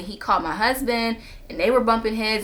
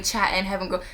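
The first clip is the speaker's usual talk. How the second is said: normal talking voice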